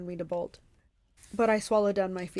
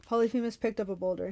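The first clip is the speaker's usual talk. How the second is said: plain reading voice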